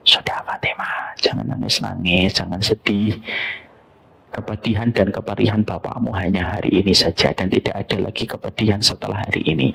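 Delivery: soft hushed words to a crying daughter; dying father whispering to his weeping daughter; whispered comfort. Retold or imitated. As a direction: whisper it close to her ear. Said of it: "membisikkan ke telinganya".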